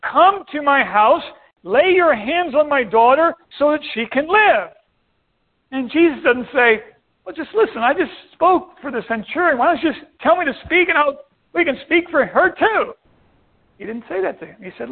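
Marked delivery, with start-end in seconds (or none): 0.0-4.7 raised-pitch, urgent plea; worked-up Jairus. Acted. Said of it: "Jairus is all worked up and says". 7.2-12.9 higher-pitched hypothetical reply; mock brush-off. Voices what Jesus doesn't say.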